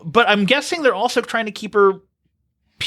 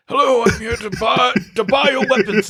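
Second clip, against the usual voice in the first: higher, affected arms-dealer voice; mock the villain's silly, theatrical voice; hammed-up villain introducing himself; mock villain impression; 5 semitones higher than usual. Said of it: "what he was going for with that voice".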